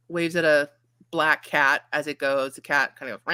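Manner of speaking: normal talking voice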